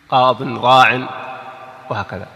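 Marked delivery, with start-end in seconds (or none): 0.1-1.1 "قاض، راع" slow, each ending in -in; tanween with kasra. Shown as a demonstration. Teacher giving examples, "قاض، راع" slow with -in endings. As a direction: draw out "قاض، راع" as qaadin, raa'in. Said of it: "التنوين بالكسر".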